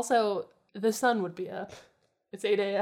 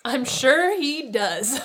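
drawn-out, high, loud sneer; haughty, scornful sneer; 6 semitones higher, 8 dB louder, much slower than usual. A narrator's reading. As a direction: sneer it slowly at the gang. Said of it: "the woman sneered".

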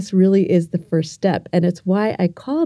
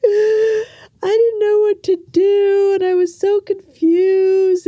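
put-on much higher, louder, slow tearful child voice; imitating her younger self sobbing out the words; crying child; 11 semitones higher, 4 dB louder, slower than usual. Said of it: "I start crying and I'm like".

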